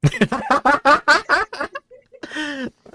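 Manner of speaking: squealing laugh, long and rising; high burst of laughter. Real, not acted. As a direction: crack up hard at the joke. Laughs at cop joke.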